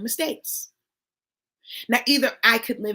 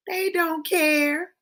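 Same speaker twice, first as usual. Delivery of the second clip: dismissive; 7 semitones higher, much slower than usual. Real, not acted. Brushing off worry.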